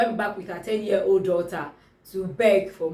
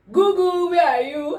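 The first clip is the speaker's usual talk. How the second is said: high, raised playful call; high-pitched "where are you" summons; 7 semitones higher, 4 dB louder than usual. Acted. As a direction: call out for Google in mock urgency. Acts out urgently searching Google.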